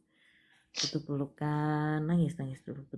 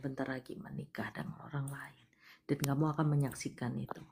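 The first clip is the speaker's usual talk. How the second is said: whispered, softer, breathy storytelling; dramatic whisper; 7 dB quieter than usual. Acted. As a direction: breathe it out like a sad secret. Reads the caller's story dramatically.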